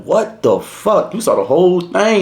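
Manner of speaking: high, stretched-out incredulous question; retold disbelief. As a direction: act out stunned mock astonishment, dragging the words. Teller retells his disbelief.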